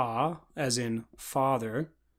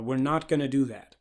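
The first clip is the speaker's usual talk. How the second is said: normal talking voice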